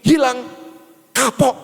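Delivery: high-pitched imitation of a warning mother; mother's scolding accent. Mother warning her child about wandering off alone, scolding imitation. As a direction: mimic mum's warning, word by punched word. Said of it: "Bahkan logatnya pun seperti itu".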